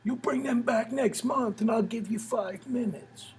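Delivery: high, soft, slow put-on voice; club booker voice. Booker making an offer to a first-timer, high slow imitation. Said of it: "he's like".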